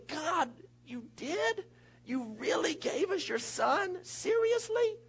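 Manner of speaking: slow, high, awed disbelief; amazed disbelief. Pretend. Believer marvelling to God, slow and high in disbelief. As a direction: marvel at the gift. Acted awe at God's gift.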